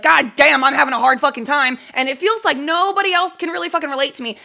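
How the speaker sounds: loud, high-pitched venting; imitating an angry kid's rant; retold anger. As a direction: act out a frustrated outburst. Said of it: "I would get so fucking mad".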